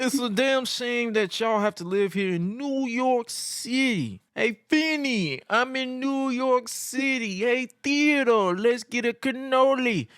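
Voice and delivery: high, slow, exaggerated New York accent. Host mocking New York crowd in a New York accent. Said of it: "Jericho's impression of a New York accent".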